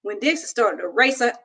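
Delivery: plain reading voice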